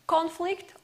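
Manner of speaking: "conflict" as a noun, CON-flict; "conflict" with strong opening, weak flict; stress on first syllable. Shown as a demonstration. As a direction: punch CON in "conflict", full o vowel. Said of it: "has the first syllable stressed".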